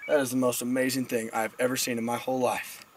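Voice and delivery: low, soft, awed thought; deadpan awe, dropped well down; hushed amazement. Acted. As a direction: say it quietly to yourself in wonder. Teller's awed thought to himself.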